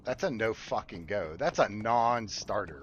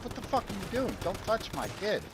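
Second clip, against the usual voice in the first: higher mock yell at a stranger; retold angry yell; 10 semitones higher than usual. Father yelling at a woman touching his kid, high mock yell. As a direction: snap it at her like a protective dad. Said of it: "be like".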